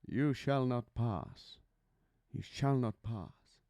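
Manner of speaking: put-on slow, grand old Shakespearean actor voice; Sir Ian McKellen. Shown as a demonstration. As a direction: draw each word out like an old stage thespian. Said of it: "that old Shakespearean speaking voice".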